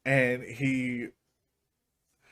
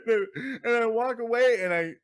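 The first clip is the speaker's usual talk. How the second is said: laughing; 8 semitones higher than usual. Real, not acted. Laughing while retelling.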